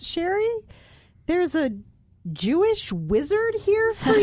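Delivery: high, sing-out call, imitating a puzzled mom; mock call up the stairs, pausing as you go; retold motherly holler, pitched well up; mom yelling upstairs. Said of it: "my mom yells up and goes".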